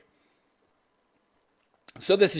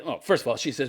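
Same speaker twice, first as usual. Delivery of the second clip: ordinary delivery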